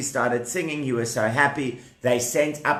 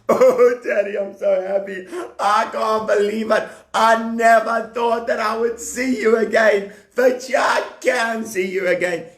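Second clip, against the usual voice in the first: crying for joy; 7 semitones higher than usual. Acted. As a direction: blubber with overwhelmed happiness, playing it big. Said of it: "He was crying 'cause he was happy".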